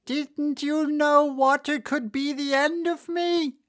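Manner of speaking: high, loud despairing wail; melting witch wailing to Dorothy. A narrator's reading. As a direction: wail it as hope leaves. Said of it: "in a wailing, despairing voice".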